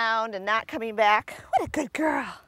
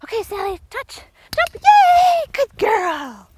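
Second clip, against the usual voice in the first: high sing-song cues bursting into a loud yay; high dog-talk cues; 9 semitones higher, 6 dB louder, slower than usual. Real, not acted. Trainer cueing Sally over the jump, high and excited. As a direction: call the jump up high, then cheer.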